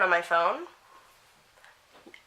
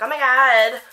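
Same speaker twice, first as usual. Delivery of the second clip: loud, higher-pitched mock fan outburst; imitated fangirl squeal; 4 semitones higher, 9 dB louder, slower than usual. Warning viewers about their inner fangirl, loud gushing imitation. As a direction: let the inner fangirl burst out. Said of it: "your fangirl wants to be like".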